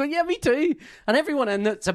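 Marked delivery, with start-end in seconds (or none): 0.0-0.7 eager reply. Retold or imitated. Friend's eager reply at concert.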